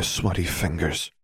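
ordinary delivery

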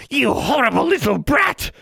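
shrill high furious scream; screaming hag. Acted. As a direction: shriek in pain and rage. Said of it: "she screamed".

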